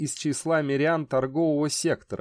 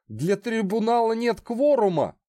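panicked trapped animal; 9 semitones higher than usual. Acted. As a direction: squeal out the protest. Condemned man protests in panic.

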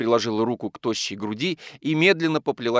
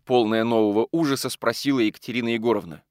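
ordinary delivery

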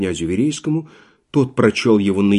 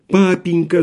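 high pleading cry of a daughter; plaintive cry; 7 semitones higher than usual. A narrator's reading. Daughter cries out to her father, plaintive. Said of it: "закричала она жалобным голосом".

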